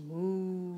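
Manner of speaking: long, low moo; cow moo. Shown as a demonstration. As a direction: imitate a cow for a toddler. Answers what the cow says.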